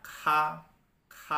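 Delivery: "K" said as an aspirated kha. Shown as a demonstration. Teacher showing pinyin K, with h behind it. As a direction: puff an h right after the "K". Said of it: "di belakang itu ada huruf H-nya".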